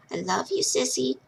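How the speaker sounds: whisper-soft like a little brother. A narrator's reading. The little brother Henry tells his sister softly he loves her. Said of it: "his sweet little voice".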